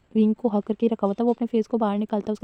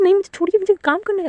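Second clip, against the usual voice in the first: high, louder panicked protest; flustered protest; 10 semitones higher, 3 dB louder than usual. Acted. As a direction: push him off, voice jumping up. Wang Ji protesting, pushing him away. (ऊँची, घबराई हुई ना-नुकुर)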